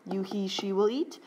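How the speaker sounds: ordinary talk, nothing marked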